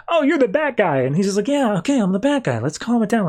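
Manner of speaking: hammed-up squeal of recognition; excited realization. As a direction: blurt it out like a starstruck fan. Characters voiced in retold comic.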